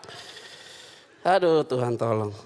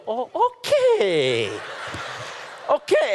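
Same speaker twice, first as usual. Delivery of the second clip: much higher, strained polite okay; forced cheerful okay; 6 semitones higher, much slower than usual. Retold or imitated. Pastor forcing a polite okay when told to split the bill. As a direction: squeak out a fake-happy okay.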